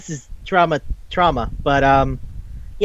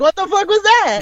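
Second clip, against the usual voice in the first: much higher, baffled outburst; stunned friend. Retold or imitated. Friend reacts to the show's ending, high and baffled. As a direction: imitate a friend blown away by the ending. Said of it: "he's like".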